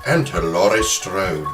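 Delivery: normal talking voice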